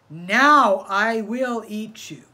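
slow, very loud threat; menacing threat. Acted. Coyote finally catches rabbit.

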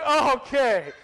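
loud, high-pitched exaggerated okay; hammed-up, loud reaction to an excuse; mock acceptance. Husband to wife after her puppy explanation, hammed-up mock acceptance. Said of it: "I'm like".

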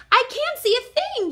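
high, loud panicky yell. A narrator's reading. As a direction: yell it out blindly. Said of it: "yelled Pip".

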